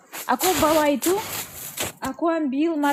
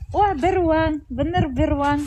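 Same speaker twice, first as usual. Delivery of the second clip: normal talking voice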